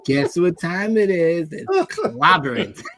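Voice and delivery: rising into a high laughing catchphrase; Thing catchphrase, laughing. Retold or imitated. Mimicking the movie Thing's line, laughing and high. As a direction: crack up as you deliver the catchphrase. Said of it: "that's what he says in the movie".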